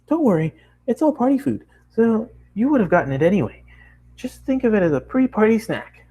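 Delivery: high, excited nonstop chatter; bubbly pony girl. Acted. Bubbly girl chatters at the new traveler, high and excited. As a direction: gush at the newcomer in a bright rush. Pinkie's voice.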